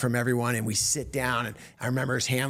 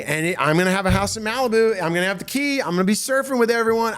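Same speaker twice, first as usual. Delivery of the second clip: higher-pitched excited psych-up, rushing into a daydream; giddy self-hype; 4 semitones higher than usual. Retold or imitated. Comic convinced Ray will put him in a movie, giddy self-hype. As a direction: replay pumping yourself up, pitched up. Hyping himself up about movie dream.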